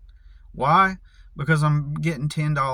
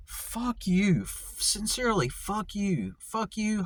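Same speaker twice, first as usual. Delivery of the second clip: high, softer mock-sincere curse; sarcastic curse; 6 semitones higher, 5 dB quieter, slower than usual. Real, not acted. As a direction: sneer it sweetly high. Cursing the advice givers.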